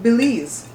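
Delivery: "Belize" said bih-LEEZ; short "bih" in "Belize", then a long "leez"; native pronunciation of Belize. Shown as a demonstration. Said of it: "it's pronounced like B I L E E Z".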